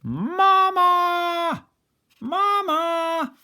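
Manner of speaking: put-on very high, loud squeaky baby-goose calls; shrill chick crying out for mama; gosling cries. Goslings call to Bruce.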